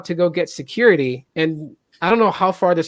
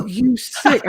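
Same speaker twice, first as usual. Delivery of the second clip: mock angry retort; 6 semitones higher than usual. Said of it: "I got mad".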